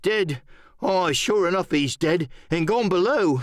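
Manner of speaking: high, emphatic sailor voice; bandaged pirate, superstitious. Acted. Bandaged pirate confirming Flint is dead, high emphatic voice. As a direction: pitch it up with uneasy force.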